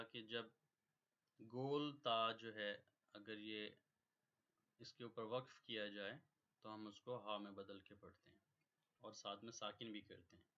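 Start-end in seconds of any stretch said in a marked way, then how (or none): none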